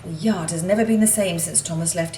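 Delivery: plain reading voice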